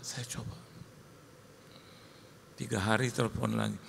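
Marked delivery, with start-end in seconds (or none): 0.0-0.6 whispered, much softer; weak whispered reply. Retold or imitated. Wife answers pastor weakly, whispered. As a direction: barely whisper it, worn out.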